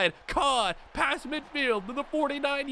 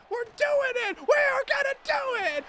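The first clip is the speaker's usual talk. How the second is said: much higher, shouting in disbelief; excited shout; 13 semitones higher than usual. Real, not acted. Player sensing the championship win: elated shouting. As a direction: cheer it out.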